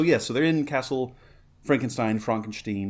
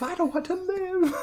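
pitched up further, a hammed-up wail; mock despairing wail. Repeating the film's line to the co-host, mock despairing wail. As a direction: push the whine even higher.

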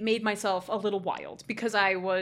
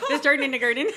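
higher words through giggles; laughing repetition; 4 semitones higher, 5 dB louder, faster than usual. Real, not acted. Repeating the silly joke to co-host, laughing. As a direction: giggle out the rhyme.

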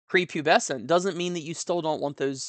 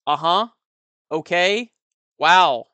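clipped, disinterested one-word answers, a bit louder; curt one-word replies; 8 dB louder, slower than usual. Retold or imitated. Bored listener to a rambler, curt disinterested replies. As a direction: brush them off with bare minimum replies. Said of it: "one-syllable answers or one-word answers".

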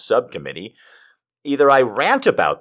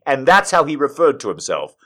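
ordinary talk

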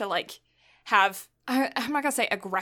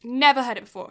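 ordinary delivery

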